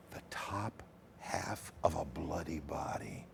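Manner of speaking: hushed, breathy ghost-story whisper; spooky whisper. A narrator's reading. Storyteller whispering the gruesome reveal to the audience. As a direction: whisper it to give the listeners chills.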